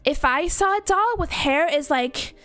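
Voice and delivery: put-on Californian doll, lilting upward; self-satisfied American doll. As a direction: confide like a vain, picky doll. Continues the doll's monologue.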